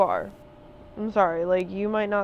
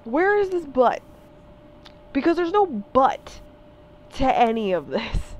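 higher and louder, demanding an answer; incredulous demand; 4 semitones higher, 4 dB louder than usual. Real, not acted. Confronting people who argue with "but", incredulous and raised. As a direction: challenge them to find it.

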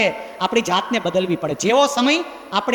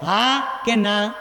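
drawn-out question; much slower than usual. Acted. Asks the parents to answer.

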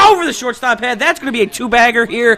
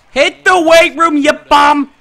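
loud, higher heckling yell; 5 semitones higher, 7 dB louder, slower than usual. Real, not acted. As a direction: shout at the screen. Yelling at his own player.

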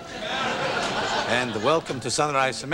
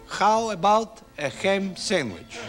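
slow, higher "How about a ham sandwich?"; slow model phrase; 6 semitones higher, slower than usual. Shown as a demonstration. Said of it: "say something else like".

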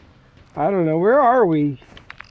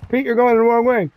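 raised, higher call to a teammate; 9 semitones higher, 6 dB louder than usual. Real, not acted. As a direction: shout the correction across. Calling teammate going wrong way.

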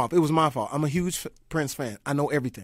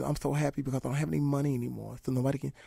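soft imitation; 9 dB quieter, faster than usual.

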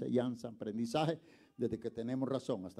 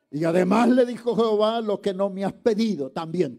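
full-force pulpit proclamation; preaching shout; 10 semitones higher, 15 dB louder than usual. Acted. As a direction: drag it out and thunder it. Preacher quoting God loudly.

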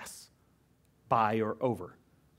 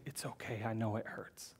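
much softer, soothing murmur; tender hush; 4 semitones lower, 15 dB quieter than usual. Acted. God sitting with the grieving pastor, soft and tender. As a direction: comfort someone who is crying. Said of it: "to sit with me and say".

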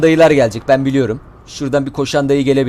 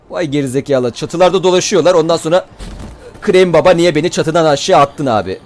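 normal talking voice

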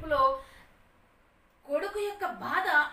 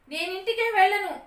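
firm resolve; 9 semitones higher, 9 dB louder than usual. Acted. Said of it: "అని పునఃనిశ్చయించుకున్నాడు".

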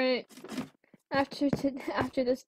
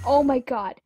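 ordinary talk

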